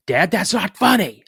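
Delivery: high, loud retold complaint; annoyed protest. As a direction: call out to dad in protest.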